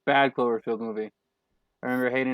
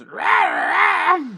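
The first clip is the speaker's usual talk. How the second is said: loud, high mock monster roar; 8 dB louder than usual. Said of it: "the monster goes".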